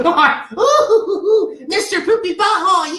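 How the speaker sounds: high squeaky cartoon voice while laughing; silly cartoon voice. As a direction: ham up a silly cartoon catchphrase. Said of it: "you can say that".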